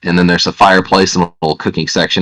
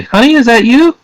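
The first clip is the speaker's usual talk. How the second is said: high, slightly louder woman greeting someone; woman's voice; 5 dB louder than usual. Acted. Woman in the cabin greeting a traveler, high woman's voice. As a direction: play the woman in a high-pitched voice. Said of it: "a voice from a woman say".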